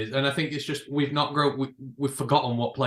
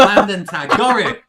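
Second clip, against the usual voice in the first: higher, louder Landon Tan in a hammed-up London accent; loud Cockney attempt; 8 semitones higher, 12 dB louder than usual. Shown as a demonstration. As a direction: belt out Landon Tan proudly. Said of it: "I can't do it".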